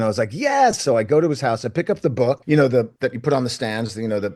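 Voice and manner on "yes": eager yes. Retold or imitated. Eager yes to job offer.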